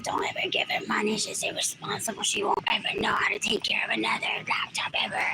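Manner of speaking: whispered mock quote of relatives. Relatives quoted about her broken laptop, whispered. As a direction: hiss their judgment under breath. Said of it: "And they're like".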